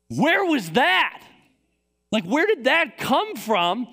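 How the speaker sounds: high, loud, drawn-out disbelief; astonished. Retold or imitated. Amazed roommate reacts to the sudden outburst, astonished. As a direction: act out amazed incredulity, stretching the words.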